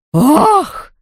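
high cry of shock; stunned gasp. A narrator's reading. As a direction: exclaim in high-pitched astonishment. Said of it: "баронесса ошеломлённо отпрянула".

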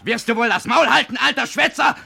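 loud, high, barking rebuke; angry shout. Acted. Son roars at the raving old man. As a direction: shut him up with a roar.

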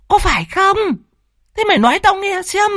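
very high, quick, pressing questions; mother's shrieky grilling, volume held in; hissed interrogation. Acted. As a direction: press for an answer in a strained high voice. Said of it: "Bà Tự vẫn rít lên".

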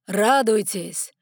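slow, booming royal cry; loud piercing proclamation. Acted. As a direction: proclaim it to the whole hall. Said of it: "голос короля сделался громким и пронзительным".

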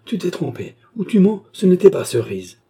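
high, agitated protest, growing loud; exclaimed denial. A narrator's reading. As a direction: refuse it and accuse him, building in volume. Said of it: "s'écria Léon Roland". (protestation agitée, aiguë, de plus en plus forte)

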